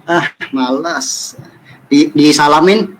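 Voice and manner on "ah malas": slow, quiet, dismissive refusal; mock-snobbish, drawn-out reluctance; arrogant brush-off. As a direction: brush them off as beneath you, dragging it out softly. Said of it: "ini namanya sombong".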